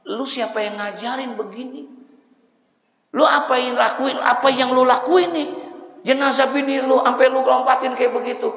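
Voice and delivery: loud, high angry rebuke; annoyed scolding. Retold or imitated. Preacher scolding the husband for jumping over the coffin. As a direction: chew him out. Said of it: "Akhirnya saya kesel".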